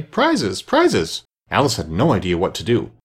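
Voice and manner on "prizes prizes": high, clamouring calls; a crowd's demand, pitched well up; confused calling out. A narrator's reading. Party crowds round Alice, calling out confusedly. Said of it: "calling out in a confused way".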